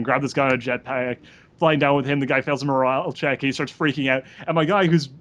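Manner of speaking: ordinary delivery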